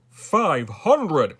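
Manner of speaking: loud, amazed royal exclamation; exclaiming. A narrator's reading. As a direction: burst out in astonishment. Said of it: "exclaimed the king".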